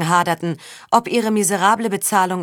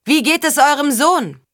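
high, raised call; calling across; 8 semitones higher, 3 dB louder than usual. A narrator's reading. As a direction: call the question over to someone at a distance. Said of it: "Saga rief zu Achard hinüber". (laut hinübergerufen, hohe Stimme)

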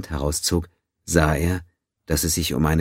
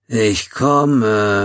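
put-on raised, high, croaking old man call; croaky old man; 6 semitones higher, 6 dB louder than usual. A narrator's reading. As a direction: call out hoarse, just woken. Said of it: "rief er mit krächzender Stimme".

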